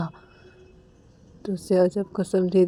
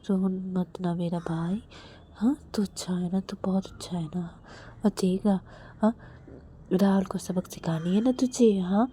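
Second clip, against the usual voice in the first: soothing; 5 dB quieter than usual. Retold or imitated. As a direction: coax a crying brother gently. Comforting crying brother softly. (प्यार से धीरे-धीरे फुसफुसाकर मनाना)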